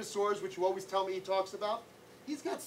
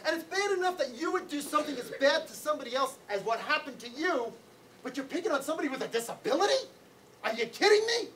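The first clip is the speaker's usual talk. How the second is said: higher-pitched, indignant telling-off; scolding mother; 7 semitones higher than usual. Retold or imitated. Mother scolding her son for bullying, imitated indignant rant. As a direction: lay into your son, pitch climbing in disbelief. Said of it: "she laid into me".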